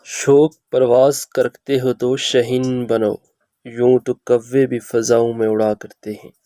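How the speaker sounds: verse recitation. Shown as a demonstration. Recites a verse for a demo recording.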